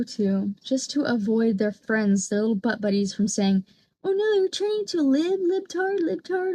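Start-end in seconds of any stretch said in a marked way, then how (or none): 4.0-6.5 higher, sneering mock taunt. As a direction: mimic a schoolyard bully's jeer. Mocking the friends' taunt.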